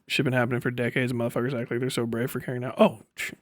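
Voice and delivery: usual speaking voice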